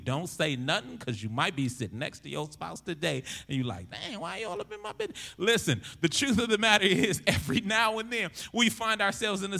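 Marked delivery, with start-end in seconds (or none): 3.9-5.2 high, hushed and fast, caught out; mock embarrassed protest. Spouse sitting next to you in church, high hushed squeak. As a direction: squeak it out like you've been exposed.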